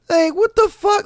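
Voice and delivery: high incredulous rant. Real, not acted. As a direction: cry out in disbelief. Ranting disbelief at squatters.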